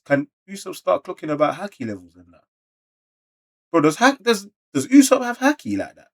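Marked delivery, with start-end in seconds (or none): 3.7-6.1 can't believe what he's reading; shocked disbelief. Real, not acted. Reacting to Usopp sensing Luffy's fate, shocked high disbelief. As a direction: pitch shoots up, louder, in disbelief.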